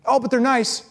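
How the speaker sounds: mock objection. Voicing a naive objection.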